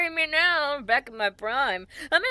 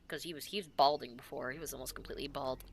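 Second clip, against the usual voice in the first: whisper; 10 semitones lower, 13 dB quieter than usual. Real, not acted.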